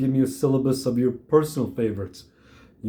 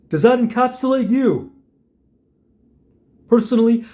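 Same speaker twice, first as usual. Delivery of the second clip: raised pitch and volume, easing gradually; angry challenge; 5 semitones higher, 4 dB louder than usual. Acted. Older man confronting the orphan kid over book learning, angry challenge.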